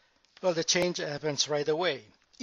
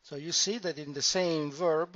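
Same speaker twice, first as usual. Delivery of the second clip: ordinary talk, nothing marked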